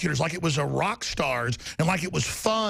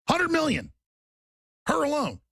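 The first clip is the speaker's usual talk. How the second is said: pitched way up in disbelief; outraged emphasis; 10 semitones higher than usual. Real, not acted. Host railing to listeners about the money, high and indignant. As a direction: spit out the sum in outrage.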